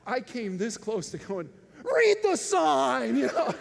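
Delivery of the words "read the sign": loud, high shout with drawn-out words; exasperated yell. Retold or imitated. Costumed gorilla nearly snapping at a boy, exasperated yell. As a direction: burst out yelling in mock exasperation. Imagined yell at disobedient child.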